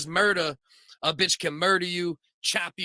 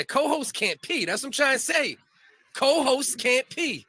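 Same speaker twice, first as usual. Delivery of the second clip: mock announcement; 8 semitones higher than usual.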